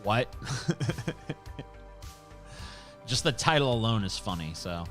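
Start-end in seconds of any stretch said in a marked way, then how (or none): none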